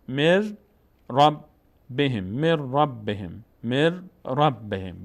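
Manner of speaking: slow مر رب with doubled r; n merged into ra. Shown as a demonstration. Teacher models the reading, مر رب بهم with no n. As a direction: join مر to رب on one held r.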